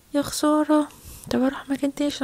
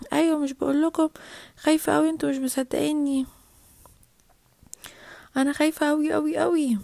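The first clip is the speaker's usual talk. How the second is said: normal talking voice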